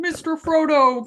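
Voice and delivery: lightly imitated dad reading aloud; a hint of dad's storybook hobbit voice; dad's Sam voice. Daughter copying dad who read it to her, lightly put-on voice. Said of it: "everybody in my dad's voice".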